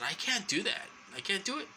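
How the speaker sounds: high-pitched retold plea; retold protest. Retelling her protest to nurse.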